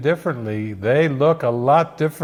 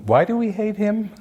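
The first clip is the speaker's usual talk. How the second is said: a higher, puzzled wondering question; wondering aloud; 6 semitones higher than usual. Pretend. To friends at the lunch table, puzzled and raised in pitch. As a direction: ask it like it just dawned on you. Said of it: "and you're going".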